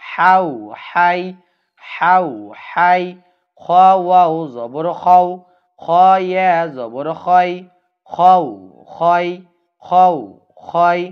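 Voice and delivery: each হাউ and হাই said loud, clear and drawn out; teacher chanting the drill loudly; clear recitation drill. Shown as a demonstration. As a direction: drill the syllables loud and distinct for pupils to repeat. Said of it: "এখানে আমরা বলবো".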